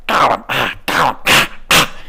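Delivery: choked, loud coughing creature; Gollum. Shown as a demonstration. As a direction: hack it out like a hairball. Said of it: "sounds like I'm coughing up something".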